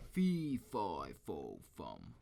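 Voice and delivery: a giant sniffing out his prey, bellowing; lightly hammed-up giant roar. A narrator's reading. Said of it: "the giant roared".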